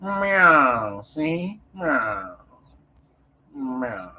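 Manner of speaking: a hint of an old movie gangster meowing; gangster cat. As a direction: meow like a tough guy, see. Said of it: "She talked like an old gangster".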